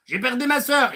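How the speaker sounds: high plaintive lament; hammed-up grief; mock whining. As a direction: moan about it like a sulker. Mock complainer listing losses.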